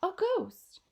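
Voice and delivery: high, loud cries of alarm; panicked yelling. Acted. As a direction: crowd crying out in mock terror. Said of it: "with everybody yelling".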